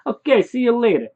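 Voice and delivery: high, loud, cheery farewell; called-out goodbye. Acted. Saying goodbye to Bob at the door, called out loudly.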